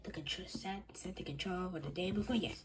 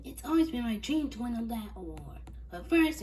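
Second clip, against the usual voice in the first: excited; 4 semitones higher, 6 dB louder than usual. Acted. Excited Snealy.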